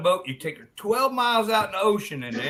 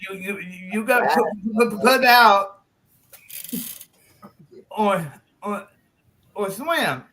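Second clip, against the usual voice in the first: nervous, stuttered ultimatum; hammed-up halting demand with long pauses; timid harelip friend; 4 semitones higher, 4 dB louder than usual. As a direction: stammer on every word, straining to get it out. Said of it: "really timid".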